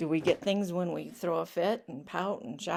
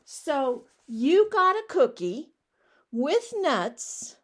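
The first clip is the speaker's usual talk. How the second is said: incredulous pigeon; 5 semitones higher, 5 dB louder, slower than usual. Acted. Pigeon questions duckling, incredulous.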